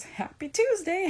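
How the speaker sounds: high, loud, drawn-out singsong cheer; humorous send-off. Retold or imitated. Therapist leaving a tense house to lighten it, jokingly cheerful. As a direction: imitate a comically bright parting call. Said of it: "she knows how to use humor".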